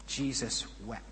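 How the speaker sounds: a quiet, grave beat; hushed reverence. Acted. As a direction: say it almost under the breath. Shortest verse, said reverently.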